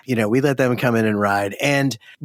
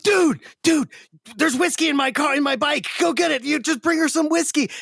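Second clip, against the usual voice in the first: high, eager and urgent; eager friends; 13 semitones higher than usual. Retold or imitated. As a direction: hurry him to get the whiskey. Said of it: "they were like".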